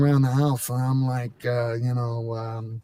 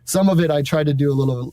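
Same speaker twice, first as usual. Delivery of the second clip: usual speaking voice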